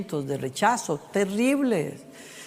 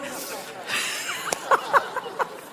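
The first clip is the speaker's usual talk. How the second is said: high laugh; 5 dB quieter than usual. Real, not acted. Laugh after croissant joke.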